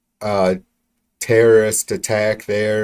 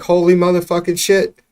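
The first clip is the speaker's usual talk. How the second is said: shocked exclamation; 9 semitones higher, 4 dB louder than usual. Real, not acted. Outraged reaction.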